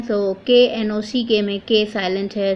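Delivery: ordinary talk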